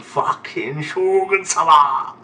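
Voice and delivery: loud, drawn-out battle cry; acted shout. Reader voices a manga character's outburst, shouting long and loud. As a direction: stretch it out into a long, rising yell.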